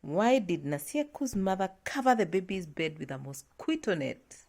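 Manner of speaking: ordinary talk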